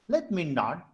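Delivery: low, measured reading of verse in feet; metrical verse reading. Shown as a demonstration. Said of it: "this will be read as this".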